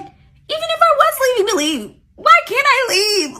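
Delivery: high, loud protest; exasperated outburst. Real, not acted. Storyteller protesting her right to leave, exasperated outburst. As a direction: burst out indignantly, voice way up. Exasperated rant at absent friend.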